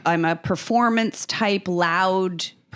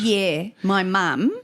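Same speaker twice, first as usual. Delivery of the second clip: put-on drawn-out Australian accent; slower than usual. Guest answering host about her parents, in a drawn-out Australian accent. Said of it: "you're always doing that accent".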